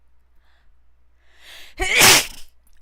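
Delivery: sudden explosive sneeze; loud sneeze. Real, not acted. Sneeze right after "I'm gonna sneeze.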